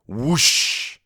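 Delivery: voiced whooshing sound effect; long airy whoosh; fireball whoosh. A narrator's reading. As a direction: imitate a fireball rushing past. Fireball flies past the hero.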